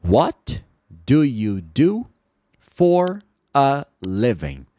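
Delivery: each word said slowly and apart, What do you do for a living; slow the line down word by word; slow and careful. Shown as a demonstration. Teacher to students: slow, careful pronunciation. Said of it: "Se você falar pausadamente".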